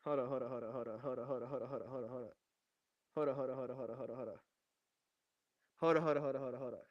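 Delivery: rapid, low, hushed muttering; muttering to self. Real, not acted. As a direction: mutter it under your breath, absently. Muttering to himself while checking stream.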